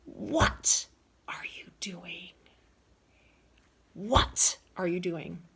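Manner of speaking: harsh whispered snarl of a furious granny; acting out Granny catching Agatha, furious; angry yell. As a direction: spit it out like a furious grandmother. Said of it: "and she yells".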